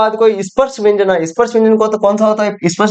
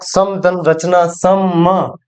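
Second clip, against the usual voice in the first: ordinary talk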